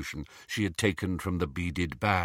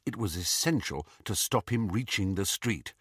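ordinary delivery

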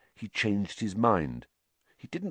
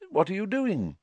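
higher, mild, unaccusing question; friendly inquiry; 12 semitones higher than usual. A narrator's reading. Teacher greeting Harry at his office door, friendly. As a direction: ask with kindly interest, no suspicion. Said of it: "in a very different voice from Filch".

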